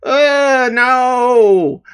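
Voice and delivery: put-on high, loud, disgusted kid voice; a middle schooler scoffing at the idea; too-cool kids. Teacher mimics students rejecting Power Rangers, high and disgusted. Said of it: "And they'd be like".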